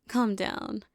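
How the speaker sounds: usual speaking voice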